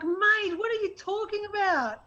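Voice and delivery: high Australian mate, quick and casual; Aussie mate. Retold or imitated. Australian explains how mates talk, in Aussie accent. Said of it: "And it's like".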